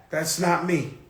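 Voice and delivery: ordinary delivery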